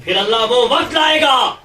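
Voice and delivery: high, loud shouted preaching; preacher shouting to the crowd, high and loud; fiery preaching. Real, not acted. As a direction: rise to the climax and shout it.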